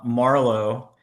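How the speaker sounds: ordinary talk, nothing marked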